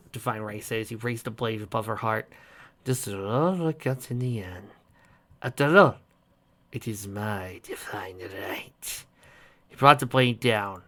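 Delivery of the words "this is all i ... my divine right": slow, sinking to a whisper; sinister villain voice. Acted. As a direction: whisper the final words.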